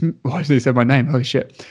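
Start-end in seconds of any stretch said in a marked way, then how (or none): none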